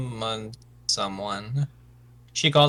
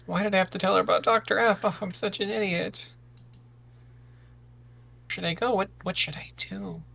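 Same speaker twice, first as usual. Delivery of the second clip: higher-pitched, hurried fretting; fretful self-reproach; 5 semitones higher than usual. Acted. Youth cast out alone, berating self, fretful. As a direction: scold yourself, then flounder for what to do.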